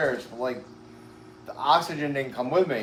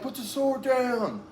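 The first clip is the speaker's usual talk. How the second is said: a mock cop command, pitched higher and drawn out slowly; stern cop order; 9 semitones higher, 3 dB quieter, slower than usual. Cop ordering the man in his backyard to drop the sword, higher mock command.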